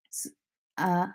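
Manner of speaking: usual speaking voice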